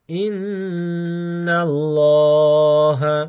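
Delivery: drawn-out melodic Quranic recitation, slow; stretched chanted Arabic with long held vowels; slow tajweed recitation. Shown as a demonstration. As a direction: recite each phrase slowly and lingeringly, as a model to copy. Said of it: "উচ্চারণগুলো আমি শুধু বলছি কয়েকবার করে".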